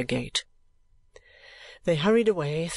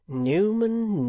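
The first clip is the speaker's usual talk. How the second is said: gentle rueful address; 6 semitones higher than usual. A narrator's reading. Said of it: "said Nicholas".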